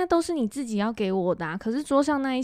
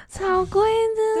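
awed; 10 semitones higher than usual. Real, not acted. Awed reaction to luxury gown.

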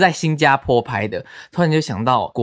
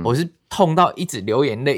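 ordinary delivery

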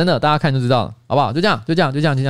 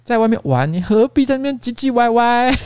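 cute coaxing staffer; 8 semitones higher than usual. Retold or imitated. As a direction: coax the grumpy boss playfully. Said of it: "突然之间这边讲".

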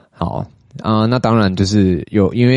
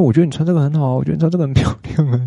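raised, sweet coaxing voice, much higher; 8 semitones higher, faster than usual. Retold or imitated. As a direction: coax her into wearing the dress. Coaxing girlfriend to wear dress. (撒娇般地哄她穿漂亮的洋装)

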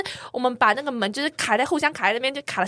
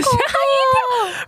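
startled; 6 dB louder, much slower than usual. Real, not acted. Startled when door handle torn off.

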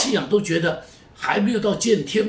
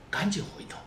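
轻声急切地耳语; 悄悄催促赶紧回头; urgent whisper; 7 dB quieter than usual. Real, not acted. 劝人回头. (压低声音急切劝人回头)

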